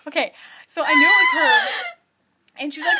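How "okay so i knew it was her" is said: high, loud yell through laughter; laughing shout. Real, not acted. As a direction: shriek at her sister while laughing. Sister interrupting.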